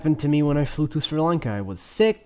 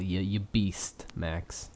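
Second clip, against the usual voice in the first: normal talking voice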